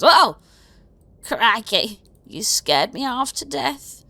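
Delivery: high, slow, startled goblin voice. Acted. Goblin librarian startled by the player, high and slow. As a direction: jump out of your skin as a little goblin.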